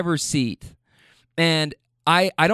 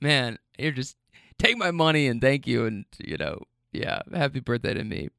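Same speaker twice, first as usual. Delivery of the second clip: defeated mumble; 2 semitones lower, 4 dB quieter than usual. Retold or imitated. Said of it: "defeated at this point".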